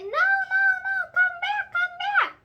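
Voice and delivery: very high, loud pleading cry; desperate shouting. Acted. Junie shrieks for her boyfriend to come back. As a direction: beg him to come back at full voice. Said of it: "I shouted".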